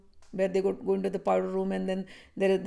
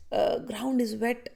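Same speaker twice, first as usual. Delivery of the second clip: ordinary talk, nothing marked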